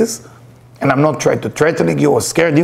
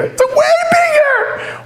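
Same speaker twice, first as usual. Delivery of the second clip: very high, drawn-out mock shriek; hammed-up hysterical squeal; panicked wife; slower than usual. Wife reporting a giant creature to her husband, mock panicked shriek.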